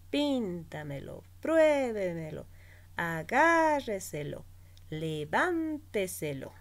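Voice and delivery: PÍN, PRUÉ, GÁ, VÁN stretched in Píntamelo, pruébemelo, agárreselo, levánteselo; stress far from the end. Shown as a demonstration. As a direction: hit and hold the accented syllable of Píntamelo, pruébemelo, agárreselo, levánteselo, then fall. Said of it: "el acento en la cuarta o quinta sílaba". (acento alargado en PÍN, PRUÉ, GÁ, VÁN de Píntamelo, pruébemelo, agárreselo, levánteselo)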